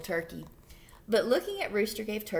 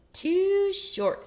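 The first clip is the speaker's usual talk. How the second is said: cow's verdict; 10 semitones higher, 5 dB louder than usual. A narrator's reading. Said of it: "said Cow".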